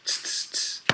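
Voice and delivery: three sharp voiceless tss sounds; monkey tsk noises. A narrator's reading. Monkeys stamping back at the peddler, voiceless tss noises. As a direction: imitate monkeys with tongue hisses. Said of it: "monkeys only stamped their feet back at him and said".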